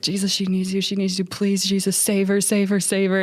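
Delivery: urgent repeated begging, mildly acted; imitated tearful pleading. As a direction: imitate someone begging through tears, not sobbing. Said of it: "And he's crying".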